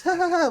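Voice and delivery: quick high mock "ha, ha, ha" copying the comedian; imitating his laugh. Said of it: "that's kinda how he laughs".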